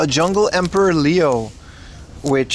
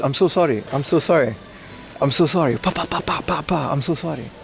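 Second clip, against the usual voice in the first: Jackie Chan impression. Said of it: "Jackie Chan is here for real".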